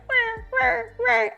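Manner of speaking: high-pitched mimicked machine whir; short rising-and-dropping mechanical imitation; imitated whirring. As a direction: make the noise of a moving gondola. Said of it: "it's like".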